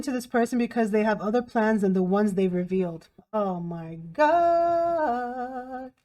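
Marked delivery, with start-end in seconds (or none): 3.3-5.9 hugely drawn-out last word, held like a note; exasperated exclamation. Real, not acted. Tarot reader reacting to hidden agendas, exasperated. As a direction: stretch it out into a long sustained wail. Exclaims over what the cards reveal.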